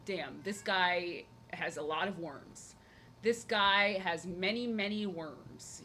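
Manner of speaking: drawn-out, slow retelling of her own reaction; slow mock disbelief. Recalling her thoughts about the new stray cat, slow and drawn out. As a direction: stretch each word slowly, mildly dismayed. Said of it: "I was like".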